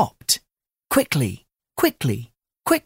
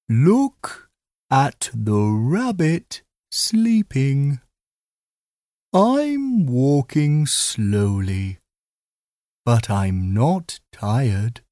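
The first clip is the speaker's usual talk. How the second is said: slow tortoise voice; slower than usual. Acted. Slow tortoise passes sleeping rabbit.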